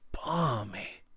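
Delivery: low voice. Acted. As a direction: say it so only Ransom hears. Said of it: "he said in a low voice".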